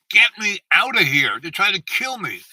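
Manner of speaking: retold urgent cry for rescue, high and loud; acted-out desperate phone call; urgent plea. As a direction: plead loudly to be fetched. Retold plea to his dad.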